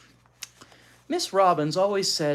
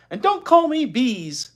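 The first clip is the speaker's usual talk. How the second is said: put-on higher, louder indignant girl; 12 semitones higher, 10 dB louder than usual. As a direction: snap back at the boy. Beezus retorts to Wayne.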